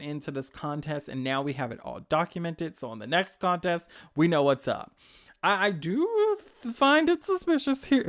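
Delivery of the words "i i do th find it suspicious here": suspicious. Real, not acted. Suspicious outburst at Webtoons.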